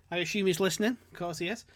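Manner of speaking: ordinary delivery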